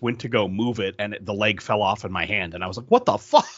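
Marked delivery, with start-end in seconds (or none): 2.9-3.5 high, loud exasperated retelling; pissed-off outburst. Retold or imitated. Toy collector retelling a leg snapping off, pissed-off outburst. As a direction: re-enact the outraged disbelief, voice jumping up. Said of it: "Like, I was pissed".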